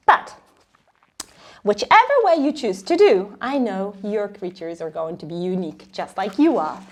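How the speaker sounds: usual speaking voice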